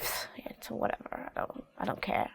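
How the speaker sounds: breathy, careless pfft, barely voiced; dismissive whisper. Shown as a demonstration. Teen shrugging off a parent, whispered and dismissive. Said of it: "It's more like".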